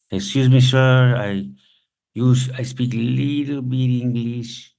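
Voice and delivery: put-on halting foreign-accented broken English; slow, accented, groping for each word; rudimentary English. Hungry Venezuelan man asking a stranger for food, halting broken English. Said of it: "Rudimentary English".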